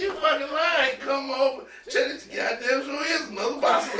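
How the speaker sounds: high-pitched comic urgency; retold frantic insisting. Urging James to come check the box, frantic. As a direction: plead high, close to cracking up. Acted argument about another possum.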